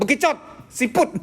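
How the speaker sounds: high-pitched mock insult; coarse name-calling. Shown as a demonstration. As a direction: hurl animal names in comic abuse. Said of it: "Kalau kasar tuh sudah ada omongan kasar". (makian kasar pura-pura)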